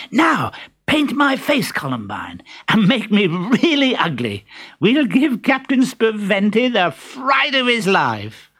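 high, gleeful scheming servant; Harlequin. Acted. Harlequin to Columbine, plotting a prank, gleeful and high.

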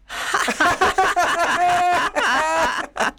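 put-on high shrieking children's laugh; shrieking laughter. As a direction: burst into shrill giggling. Twins laugh at ghost.

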